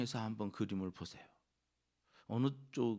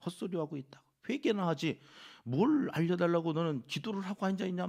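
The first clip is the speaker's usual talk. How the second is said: higher pitched, scolding rebuke; mock accusation; 7 semitones higher, 5 dB louder than usual. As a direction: berate him for praying that way. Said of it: "막 이렇게 비난하는 거죠". (친구들이 욥을 몰아세우며 높은 목소리로 비난하는 말)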